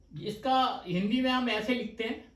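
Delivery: ordinary talk, nothing marked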